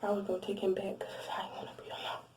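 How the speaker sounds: whispered, barely audible aside; whispered confession. Real, not acted. Admitting to viewers she'd take him back, whispered. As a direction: confess it under your breath.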